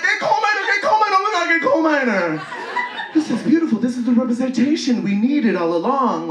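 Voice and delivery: high, loud squealing delight; ecstatic gushing. Retold or imitated. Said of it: "I'm like".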